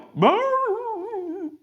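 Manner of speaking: high, long howling dog yelp. Acted. Storyteller mimics the dog at the gas station, long yelp. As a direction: imitate a dog yelping, drawn out and high. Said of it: "a dog yelp".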